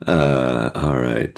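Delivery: usual speaking voice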